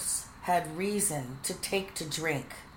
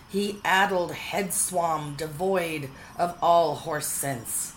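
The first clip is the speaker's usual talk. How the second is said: ordinary delivery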